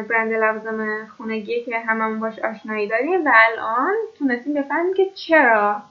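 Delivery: ordinary talk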